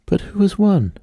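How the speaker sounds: high, eager cry of who won; high squeaky excited asking; eager question. Acted. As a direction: ask it high and breathless like excited animals. Panting and asking.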